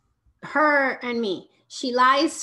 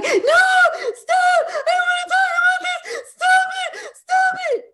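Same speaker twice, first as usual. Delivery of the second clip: put-on high, loud shrieking child tantrum; screaming child; 11 dB louder than usual. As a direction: shriek it like a panicked kid refusing to talk. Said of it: "she's like".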